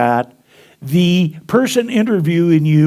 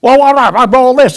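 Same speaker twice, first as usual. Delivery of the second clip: mock indignant lady; 8 semitones higher, 8 dB louder than usual. Lady retorts in retold talk.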